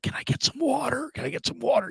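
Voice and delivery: imitating a feeble patient, high and soft; weak sick voice. Sick dad asks for water after tubes, weak sick voice. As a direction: plead softly in a thin, high voice. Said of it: "he's had tubes down his throat".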